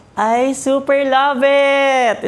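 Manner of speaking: gushing delight. Real, not acted. Gushing over new furniture.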